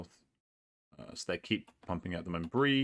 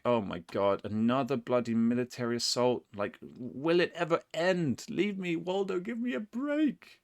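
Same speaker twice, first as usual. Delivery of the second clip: mock exasperation; 7 semitones higher than usual. Player begs opponent for a break, mock-exasperated wail. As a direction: plead in hammed-up despair, climbing ever higher.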